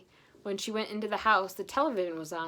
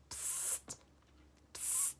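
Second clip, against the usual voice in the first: quiet whispered psst; soft psst; 16 dB quieter than usual. Acted. Roger softly calling through the window, whispered. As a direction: hiss it softly to get attention. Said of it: "he said softly".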